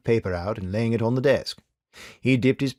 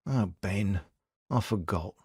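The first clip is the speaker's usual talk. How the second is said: ashamed; 2 semitones lower, 6 dB quieter, slower than usual. A narrator's reading. Tim ashamed apology.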